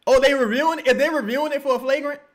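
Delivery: sudden agitated query; surprised questioning. Real, not acted. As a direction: ask it loud and incredulous. Surprised by the review.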